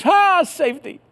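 high, loud sigh of relief; mock relief. Safe inside the boat with curtains pulled, mock relief. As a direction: let it out like you finally made it.

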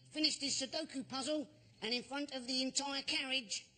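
usual speaking voice

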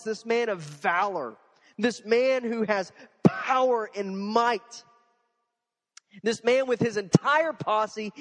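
rising, slow, emphatic build of titles; preaching at full force. Acted. Builds up Naaman's grandeur.

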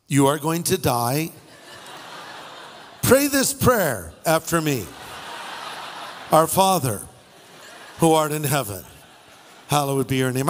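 lightly voiced solemn prayer, low; hammed-up last rites; mock doom prayer. Warning paddlers to pray before the sharks, mock solemn. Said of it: "or better yet".

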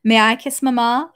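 plain father's question; Nikolai's voice. Acted. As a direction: ask it as the father, not the child. Wait, sorry.

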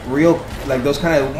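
ordinary talk

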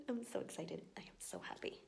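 hushed excited whisper; soft giddy whisper. Real, not acted. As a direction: whisper it like a secret.

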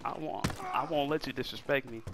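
normal talking voice

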